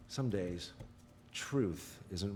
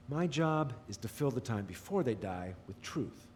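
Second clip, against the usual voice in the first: ordinary talk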